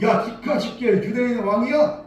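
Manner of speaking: loud mockery. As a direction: taunt him in front of the crowd. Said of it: "조롱하면".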